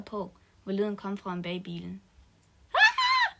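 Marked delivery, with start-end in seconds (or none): 2.7-3.4 very high, loud shriek of fright; startled scream. Acted. Two girls find their bloodied friend, shrieking scream. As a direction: scream as you see him. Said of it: "lød det fra begge af pigerne".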